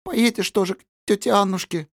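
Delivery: high, timid, trembling question. Acted. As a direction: ask shyly, voice shaking. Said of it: "робко ... трепещущий, охрипший голосок".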